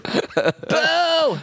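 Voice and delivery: high booing call; imitating the crowd booing; mock boo.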